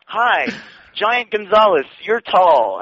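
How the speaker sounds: Donna. Acted. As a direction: deliver each obvious remark in her put-on accent. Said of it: "the questions are the best".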